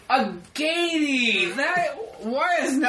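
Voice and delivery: high, stretched-out exclamation; amazed delight. Real, not acted. Reacting to co-host's coined word, amazed.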